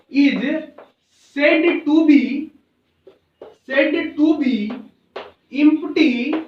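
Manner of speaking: each word spaced out, slow and loud for writing; slow dictation. Acted. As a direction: dictate it word by word, repeating phrases. Dictating a definition to students.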